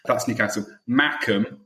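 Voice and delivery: ordinary talk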